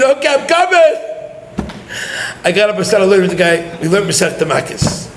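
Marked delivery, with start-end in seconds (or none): 0.0-1.0 mock scream. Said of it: "I screamed".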